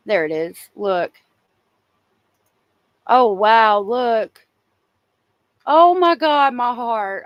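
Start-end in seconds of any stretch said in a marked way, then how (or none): 3.1-7.2 loud, high, overwhelmed gasp of emotion; gushing over a touching photo; moved exclamation. Real, not acted. Streamer reacting to a child's funeral photos, overwhelmed and gushing.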